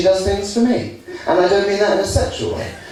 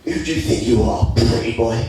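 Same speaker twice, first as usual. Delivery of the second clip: tough guy; 7 semitones lower than usual. Retold or imitated. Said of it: "he says".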